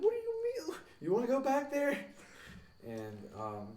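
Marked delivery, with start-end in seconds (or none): 0.0-2.0 very high, squealed comeback; incredulous put-on squeak; mock indignant retort. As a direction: exaggerate the protest way up high. Retelling his retort to the driver.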